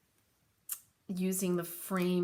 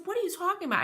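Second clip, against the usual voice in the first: incredulous retort; 10 semitones higher, much faster than usual. Retold or imitated. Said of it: "I said".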